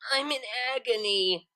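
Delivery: high, slow theatrical moan; hammed-up agony. A narrator's reading. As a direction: play the dying diva. Lyra flops back dramatically.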